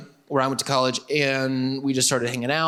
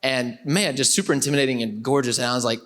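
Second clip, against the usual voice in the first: ordinary talk, nothing marked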